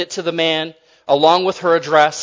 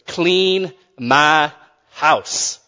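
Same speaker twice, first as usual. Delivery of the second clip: drawn out; much slower than usual. Acted. Repeats punchline drawn out.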